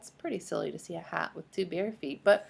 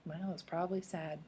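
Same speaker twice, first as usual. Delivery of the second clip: ordinary delivery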